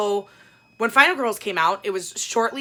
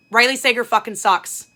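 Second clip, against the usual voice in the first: loud and higher, slammed down at the end; loud repeat; 4 semitones higher, 8 dB louder than usual. Real, not acted. Repeating the verdict to viewers, loud and emphatic. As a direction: restate it at full volume. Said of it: "I said it".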